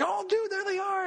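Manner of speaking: high-pitched retold thrill; reliving an excited discovery. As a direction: exclaim it high, like an excited fan. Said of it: "I was getting so excited".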